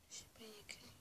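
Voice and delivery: whispered aside reading the label; quick whisper. Real, not acted. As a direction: mutter it half to yourself in a whisper. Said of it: "je chuchote un peu".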